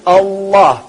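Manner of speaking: tongue demo. Shown as a demonstration. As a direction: let each "Allah" fall in a slow steady pulse. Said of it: "That's what the tongue is doing".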